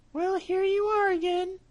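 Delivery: a trusting mouse sending him off; little mouse. Acted. Mouse greets cat.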